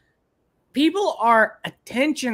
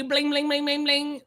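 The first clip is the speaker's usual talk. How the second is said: imitated bike bell; 7 semitones higher, 4 dB quieter than usual. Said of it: "They did a little jingle bell".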